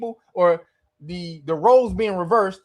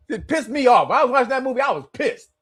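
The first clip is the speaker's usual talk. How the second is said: usual speaking voice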